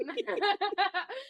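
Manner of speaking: light sheepish laughter. Real, not acted. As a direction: giggle under the breath. Keeps laughing at spoiler.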